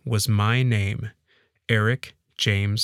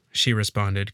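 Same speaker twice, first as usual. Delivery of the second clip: ordinary delivery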